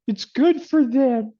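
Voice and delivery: mock-innocent sneer at the narrative; sarcastic mock voice. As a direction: sing it out like an obvious fib. Mocking the official story.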